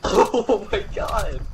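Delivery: laughing out oh my god; slow, laughing disbelief. Real, not acted. Laughing at the play.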